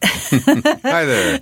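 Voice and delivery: high, laughing hi; laughing hello. Real, not acted. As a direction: greet through a laugh.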